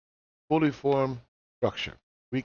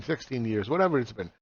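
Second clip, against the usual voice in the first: ordinary talk, nothing marked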